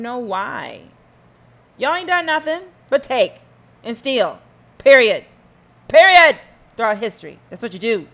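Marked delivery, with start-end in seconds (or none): 4.8-5.2 1 emphatic period. Real, not acted. 5.9-6.4 higher and louder, slammed down; final loud period; louder emphatic period. Real, not acted.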